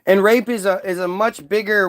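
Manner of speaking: ordinary delivery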